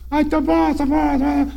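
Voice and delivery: passarinho agudo e estranho; voz fina de pássaro; voz do quero-quero. Retold or imitated. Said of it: "E imitou". (padre faz o quero-quero responder, agudo)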